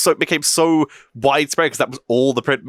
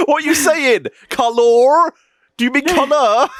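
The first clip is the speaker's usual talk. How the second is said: mock incomprehension; 13 semitones higher, 5 dB louder than usual. Mocking the confused spellchecker, laughing.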